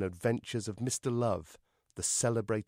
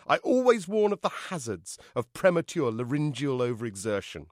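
loud, higher theatrical mother; effusive Echo; 5 semitones higher, 7 dB louder than usual. Acted. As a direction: gush grandly at your darling. Echo's theatrical entrance speech.

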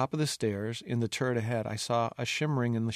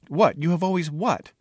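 higher, louder insistent question; impatient demand; 6 semitones higher, 6 dB louder than usual. Acted. Princess urging the dying fool to finish, impatient. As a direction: demand the rest of the sentence, pitch raised.

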